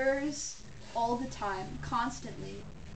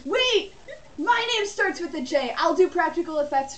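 high, loud excited realization; 5 semitones higher, 6 dB louder than usual. Real, not acted. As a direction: blurt it out, thrilled.

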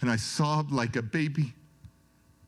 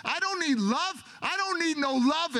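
higher retold refusal, insisting; defiant refusal; 10 semitones higher than usual. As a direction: hold the wall up. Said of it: "I'm like".

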